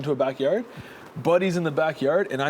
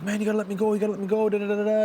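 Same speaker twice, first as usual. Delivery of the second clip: high mock whining plea; retold pleading, high and running on; imitated tearful plea; 6 semitones higher, much faster than usual. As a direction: mimic a crying guy begging, pitched up. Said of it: "he's literally crying".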